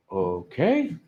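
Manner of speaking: low, soft, rising to a question; wary agreement. Acted. Comic asked to stay alone with the star, wary and soft. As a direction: draw it out uncertainly.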